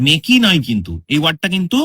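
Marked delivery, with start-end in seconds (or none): 0.1-0.4 মেকি in two syllables, ending in an "ee"; final E wrongly sounded. Shown as a demonstration. Teacher warning about silent E, মেকি mispronounced with final "ee". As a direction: voice the silent E of make, giving মেকি. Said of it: "মেকি নয়, এটাকে মেক বলা হচ্ছে".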